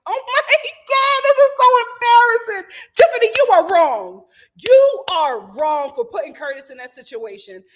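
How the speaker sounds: high, loud scolding; embarrassed outburst. Real, not acted. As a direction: cry out in secondhand embarrassment.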